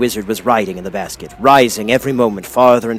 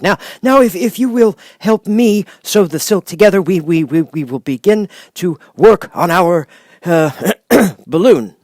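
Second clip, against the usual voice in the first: put-on higher, halting old wizard, tripping on words; a nervous humbug who can't get a sentence out; stammering wizard; 8 semitones higher, 4 dB louder than usual. A narrator's reading. The Wizard stammers his plan to Dorothy, halting. Oz plans sewing.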